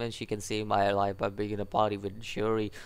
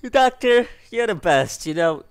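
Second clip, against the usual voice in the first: much higher and louder, thrilled; excited gushing; 8 semitones higher, 10 dB louder than usual. Real, not acted. A player gushing about the doctor, thrilled and loud. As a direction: cheer for your favourite character.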